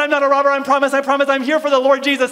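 high, loud frantic assurance; panicked pleading. Retold or imitated. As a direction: blurt your innocence in a hurry. Frantic explanation to frightened man.

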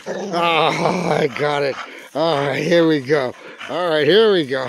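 loud, excited cheering. Real, not acted. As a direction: cheer the dog on. Excited play with the dog.

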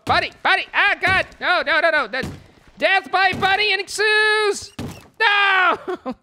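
very high, loud despairing yell; loud high-pitched cries of no; panicked shout. Real, not acted. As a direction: scream as the bunnies kill you. Character dying to bunnies.

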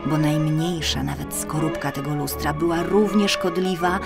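ordinary delivery, nothing marked

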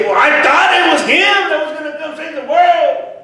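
high, loud cry of disbelief; retold disciples shouting out their disappointment; mock shout. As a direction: belt it like a let-down follower.

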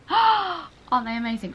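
gasp, then high and loud delight; swooning over new shoes; excited gasp. Real, not acted. Showing shoes, gasps.

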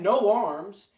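plain reading voice

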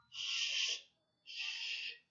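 unvoiced sh hushing sound. Shown as a demonstration. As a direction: model a long sh hiss. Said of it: "hacen el sonido sh". (sonido sh sin voz)

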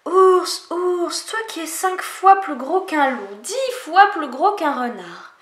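small animals in disbelief; astonished animals. Acted. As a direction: animals amazed the bear wants in. Animals exclaim at the bear.

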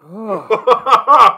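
groaning laugh; disgusted groan laugh. Real, not acted. Reacting to bitter shot.

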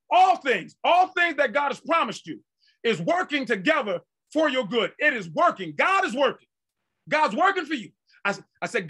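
insistent, rhythmic declarations with punched key words; fervent preaching. Real, not acted. Preacher declaring God is working for them, fervent preaching. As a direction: drive each repetition home to the congregation.

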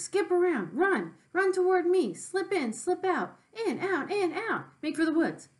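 high, loud goose voice urging; a goose yelling at the pig to dodge; goose crying out. Acted. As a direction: honk it out like an excited goose. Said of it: "cried the goose".

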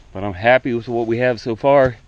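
normal talking voice

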